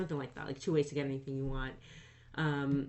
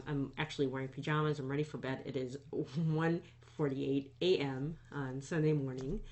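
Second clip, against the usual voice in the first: usual speaking voice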